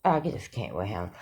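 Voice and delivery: low, hushed disbelief; exasperated. Real, not acted. Vlogger done with the accused, low and exasperated. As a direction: drop low and soft, giving up on him.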